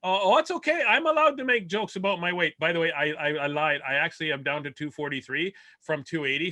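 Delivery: ordinary talk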